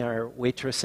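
normal talking voice

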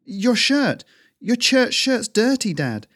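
put-on startled boy. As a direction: gasp it out, shocked at the dirt. Kai startled by father's shirt.

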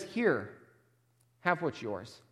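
a lightly voiced cold brush-off; curt, dismissive servant. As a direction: hand it back as if you don't care. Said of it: "Do you catch the tone of dismissal".

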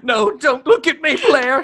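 high, loud mock-tragic cry; hammed-up tragic wail. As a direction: wail it like a ruined diva. Mock tragic [name] hiding face.

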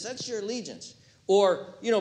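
usual speaking voice

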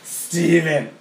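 put-on loud, high drunken call of a name; imitated drunk woman. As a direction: call out like someone wasted. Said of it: "she got wasted and was like".

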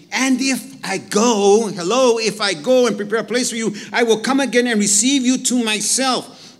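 proclaiming scripture. Acted. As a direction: proclaim the verse with fervour. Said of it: "Verse three".

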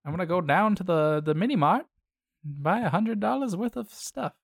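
put-on high old-fashioned radio voice; old-timey voice. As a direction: sound like an old newsreel. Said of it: "just go like".

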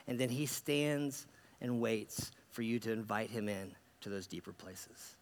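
soft, low, unhurried pastoral close; reflective invitation. Real, not acted. Pastor inviting listeners to let Jesus in, softly reflective. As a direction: gently lead them inward, with pauses.

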